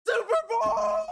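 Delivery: high, joyful scream of triumph; ecstatic shout. Real, not acted. Fan screaming at the winning kick, high joyful shout. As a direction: yell it out as the kick goes in. Kicker makes Bengals Super Bowl.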